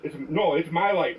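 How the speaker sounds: normal talking voice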